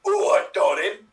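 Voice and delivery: lightly hammed-up gruff builder. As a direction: imitate a builder calling out. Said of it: "gruff, racist-looking builder's like".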